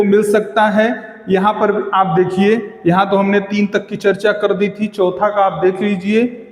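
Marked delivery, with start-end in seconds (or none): none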